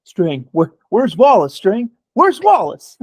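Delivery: building to a high, loud cry; mock desperate demand. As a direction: act out a pleading outburst, rising sharply. Quoting The Wire, acted desperate demand.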